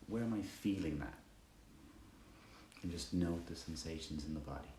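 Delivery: soft, unhurried meditation guidance; guided meditation. Acted. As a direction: guide them gently through body and breath, pausing between phrases.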